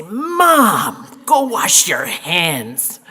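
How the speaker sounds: mock the daughters scolding their mother; imitating the daughters. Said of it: "Liz and Carol would say".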